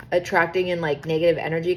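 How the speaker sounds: ordinary delivery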